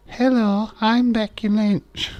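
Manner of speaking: high, slow mock Irish; Irish accent, pitched high and slow. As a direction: sing it out like a put-on Irish lass. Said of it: "try the Irish accent".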